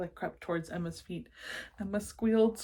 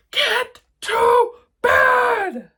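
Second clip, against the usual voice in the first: slow, much louder monster roar; thundering roar; 16 dB louder, much slower than usual. Acted. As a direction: bellow it out like a furious beast. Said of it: "Gabe thundered".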